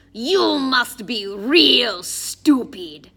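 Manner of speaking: heavy, forceful villain voice; menacing Russian thug. A narrator's reading. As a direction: drag out each word with contempt. Russian thug insults Addison.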